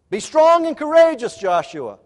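loud, high call to be strong; mighty rallying command; booming command. Acted. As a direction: proclaim it like a battle charge. God's command to Joshua quoted.

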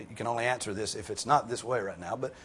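ordinary talk, nothing marked

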